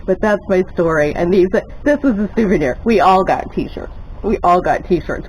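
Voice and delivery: ordinary talk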